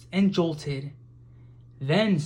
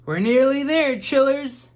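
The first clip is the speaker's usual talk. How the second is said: put-on much higher, louder call to the children; cried out; 9 semitones higher, 6 dB louder than usual. A narrator's reading. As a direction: call over the engine noise. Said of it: "cried Grandude".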